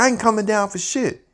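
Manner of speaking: high, firm refusal; defiant refusal. Retold or imitated. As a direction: dig in your heels. [name] repeats refusal.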